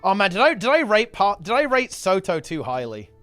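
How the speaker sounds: usual speaking voice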